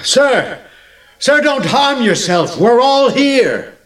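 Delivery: high, carrying call from afar; high-pitched urgent shout, last words drawn out. Acted. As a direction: call out to stop him in time. Prisoner calls out to stop jailer.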